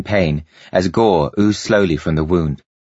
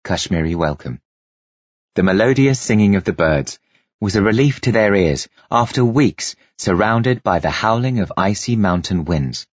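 ordinary delivery, nothing marked

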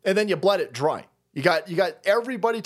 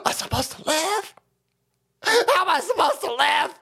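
high, tearful crybaby whine; mock wailing; 7 semitones higher than usual. Host mimicking helpless complainers, mock despairing wail. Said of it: "These motherfuckers sit there and go".

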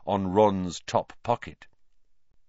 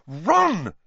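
sharp; 10 semitones higher, 6 dB louder than usual. A narrator's reading. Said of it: "said Hermione sharply".

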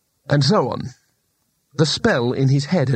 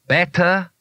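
demanding; 5 semitones higher than usual. A narrator's reading. Shopkeeper demanding approval after changing the ceiling. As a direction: insist on an answer. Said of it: "he demanded".

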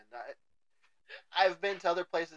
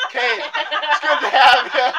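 laughing; 12 semitones higher, 16 dB louder, much slower than usual. Real, not acted. Mock farewell, laughing.

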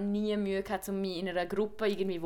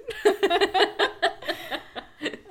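bursts out laughing loud; big laugh after singing; 10 semitones higher, 6 dB louder than usual. Real, not acted.

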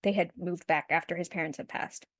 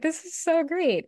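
high-pitched pleased gushing, acted out; mock delight; 9 semitones higher than usual. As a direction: voice her delight in a lifted, higher pitch. Acted quote, at first delighted.

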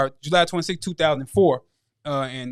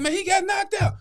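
mock taunt. Taunting the fighter's brother.

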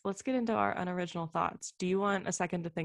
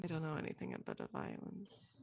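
much lower and softer put-on Italian accent; mock Italian accent; 12 dB quieter than usual. Shown as a demonstration. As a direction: mumble it low in a fake Italian accent. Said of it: "Was that French or?".

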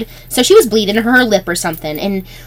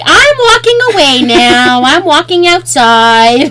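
slow, loud, higher singsong exit line; drawn-out announcement; 3 semitones higher, 4 dB louder, much slower than usual. Retold or imitated. Witness backing out of a coworkers' fight, drawn-out announcement. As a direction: stretch each word, loudly excusing yourself. Retells slipping away from fight.

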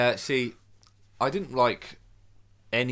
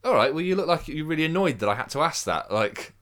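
higher, louder mock confrontation; raised-voice comedian taking someone to task; imitating comedian's challenge; 9 semitones higher, 10 dB louder than usual. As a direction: have a go at an audience member, retold. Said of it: "he just has a go at him".

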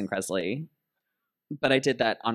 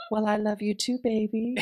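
high sweet drag queen; 10 semitones higher than usual. Retold or imitated. Sonique voiced warmly.

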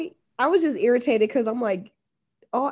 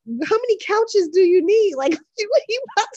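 high, fast disbelieving laugh; can't believe it, laughing; incredulous; 8 semitones higher, 4 dB louder than usual. Real, not acted. Incredulous reaction to furniture.

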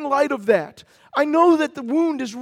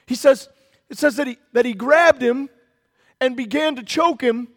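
ordinary delivery

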